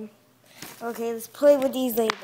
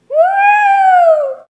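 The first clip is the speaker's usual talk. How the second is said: excited whoop; 16 dB louder than usual. Acted. Cheering cry.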